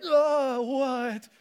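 high, loud startled "Oh, what?"; shocked outburst. Retold or imitated. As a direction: jump out of your skin at the sight. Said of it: "but I'm like".

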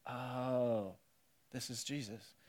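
hushed realization. Retold or imitated. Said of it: "disciples would have been like".

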